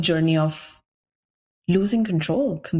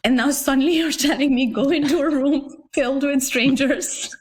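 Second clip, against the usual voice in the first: higher-pitched mock disbelief, laughter breaking in; incredulous; 8 semitones higher than usual. Retelling being sent to meet strangers, incredulous. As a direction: protest in amused disbelief, pitched up. Retold protest at sudden reversed advice.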